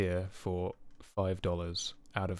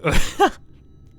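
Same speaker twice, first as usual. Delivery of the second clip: loud high laugh; 12 semitones higher, 13 dB louder than usual. Real, not acted. Laughing at spam email.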